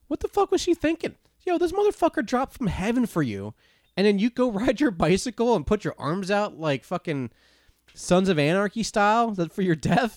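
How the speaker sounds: high-pitched exasperated rant; worked-up outrage. Retold or imitated. Moviegoer ranting at the ending, high comic outrage. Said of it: "I get so mad".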